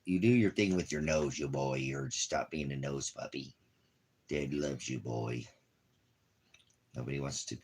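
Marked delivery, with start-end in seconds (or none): none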